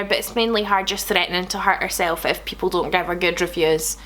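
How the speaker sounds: normal talking voice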